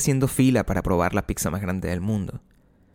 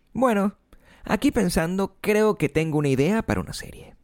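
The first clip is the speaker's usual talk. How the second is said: casual innocent; 9 semitones higher than usual. Pretend. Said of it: "como quien no quiere la cosa".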